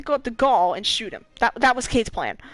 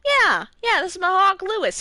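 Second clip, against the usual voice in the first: a hint of a proud, breezy Hawthorne, pitched higher; Hawthorne voiced; 9 semitones higher, 3 dB louder than usual. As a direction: toss it off like she owns the bird, higher and louder. Hawthorne quoted showing off hawk.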